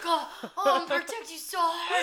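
high, loud, climaxing groan; hammed-up straining, as if climaxing; Harry Truman. Sheriff climaxing over protecting Josie, strained high groan. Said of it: "coming based on the fact of how much he's gonna protect".

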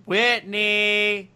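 loud, high call up the stairs; yelling upstairs. Acted. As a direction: call her down from below. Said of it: "he yelled up the stairs".

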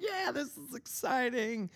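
slow, drawn-out mock excitement with no heart in it; sarcastic fake enthusiasm. Pastor watching his water bill rise, slow sarcastic cheer. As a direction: say it like you're pretending to care. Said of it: "Not really".

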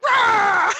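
sudden high, loud imitated dog bark. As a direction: bark it out like an angry little dog. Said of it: "and there's like".

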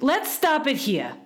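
high-pitched fretful complaint. A narrator's reading. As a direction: cut it short crossly. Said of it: "fretfully turning away his head".

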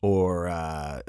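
ordinary talk

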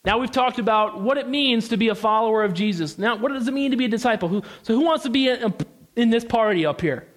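usual speaking voice

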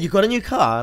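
imitation British inflection; British question inflection. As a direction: mimic a British question, high on CAR. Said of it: "slight British inflection when he asks a question".

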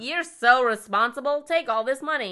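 hammed-up trusting boss; mock trusting. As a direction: hand it over with fake trust. Continues mocking dealership voice.

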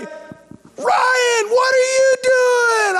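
high, drawn-out yard holler; hollered greeting. Retold or imitated. Cousins calling to Ryan from the front yard, hollering.

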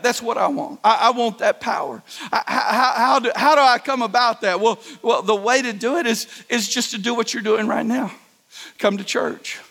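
ordinary talk, nothing marked

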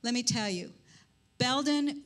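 ordinary delivery

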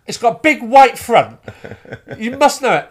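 high, loud, insistent mimicry; exasperated insistence. Actress pressing audience member about a shop, exasperated. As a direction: press the point as a frustrated performer. Actor pressing man who doesn't know.

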